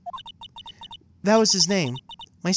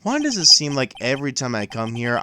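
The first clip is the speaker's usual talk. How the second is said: ordinary talk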